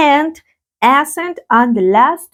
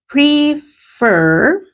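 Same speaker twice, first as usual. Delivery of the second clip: Prefer very drawn out as PRE, then FER; syllable by syllable; 5 semitones higher than usual. Shown as a demonstration. Teacher spelling out the rule, Prefer broken into two syllables. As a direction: split Prefer in two, raised and slow. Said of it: "la entonación está en la última sílaba".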